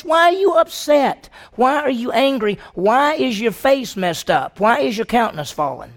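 high, loud insistent questions; God questioning. Acted. God confronting sulking Cain, loud high questions. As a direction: press him, almost pleading.

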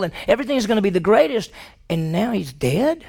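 fast, high-pitched protest piling up hopes; bewildered disciple. Acted. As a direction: rattle it off as a confused follower. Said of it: "And you may say".

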